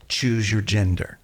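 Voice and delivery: ordinary talk, nothing marked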